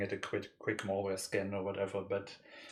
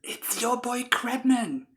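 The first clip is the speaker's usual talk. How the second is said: hyped intro; 12 dB louder, much slower than usual. Acted. Stream intro catchphrase.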